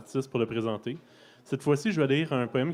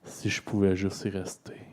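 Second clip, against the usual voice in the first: resigned spoken-word ending, voice dropping; sombre poetry reading. Acted. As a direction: let each line sink away. Poem sinks back into darkness.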